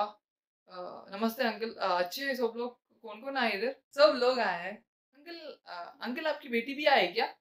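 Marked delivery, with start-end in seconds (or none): none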